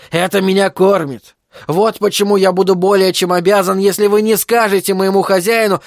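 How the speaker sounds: voiced freak begging them to keep a secret; pleading circus freak. A narrator's reading. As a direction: plead insistently with the visitors.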